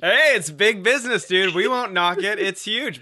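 high, loud, enthusiastic encouragement; excited. Real, not acted. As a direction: hype him up, loud and eager. Cheering on OnlyFans idea.

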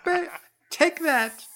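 very high taunting voice; mock fighting taunt. Guest beating up Stephen, very high mock taunt.

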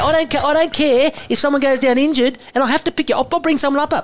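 laughing impression of a harsh coach tearing into a player; imitating Eddie Jones berating. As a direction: bark the put-down like a brutal coach, half laughing. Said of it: "I'll go in and out of his voice".

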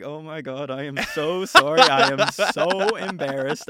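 high, loud and slowing apology; panicked apology. Retold or imitated. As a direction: gush out a mortified, panicked sorry. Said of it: "And I'm like".

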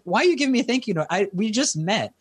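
retold surprise at a stranger's gift; surprised. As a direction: caught off guard by a thank you note. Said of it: "And I was like".

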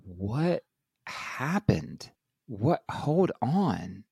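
high, bewildered questions; dazed disbelief; stunned confusion. Retold or imitated. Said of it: "I'm like".

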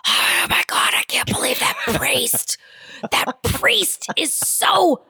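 imitating a husband who can't whisper; loud stage whisper. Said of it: "his whisper is always like".

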